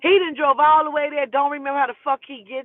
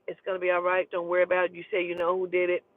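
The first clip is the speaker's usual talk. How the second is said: lower, softer voice imitating police; calm reassurance; 6 semitones lower, 5 dB quieter, faster than usual. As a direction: settle her down, low and even. Police calming her down.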